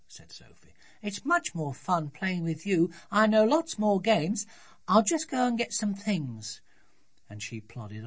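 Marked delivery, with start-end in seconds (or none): 1.0-6.6 put-on high, eager child's voice; high-pitched childish enthusiasm; keen little daughter. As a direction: play a small girl keen to carry on.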